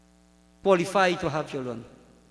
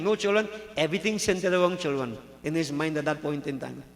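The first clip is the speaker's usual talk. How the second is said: usual speaking voice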